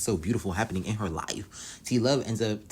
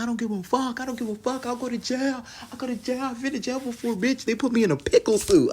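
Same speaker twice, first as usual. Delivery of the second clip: squeaky, much higher unhinged screech; wild mock Drea; 4 dB louder than usual. Drea wildin' at practice, a high squeaky mock screech. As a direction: play her like she's lost it. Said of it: "Drea is wildin'".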